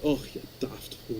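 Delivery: put-on soft, grumbling old Scot; Scottish grandfather. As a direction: scold gently in Scottish brogue. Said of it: "do impressions of his father".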